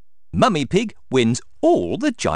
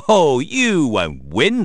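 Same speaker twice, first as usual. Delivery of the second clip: louder, slow, drawn-out taunt; laughing scoff; 5 semitones higher, 5 dB louder, slower than usual. A narrator's reading. Stallholder scoffing at Mummy Pig's chances, laughing. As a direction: laugh it off, stretching the words. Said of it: "laughs Mister Labrador".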